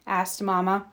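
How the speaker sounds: usual speaking voice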